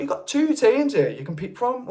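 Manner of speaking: high-pitched mock protest to Manchester supporters; retold incredulous challenge. Telling Brummie Manchester fans off, retold protest. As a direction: act out querying a fellow Brummie's choice. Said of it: "and I'm like".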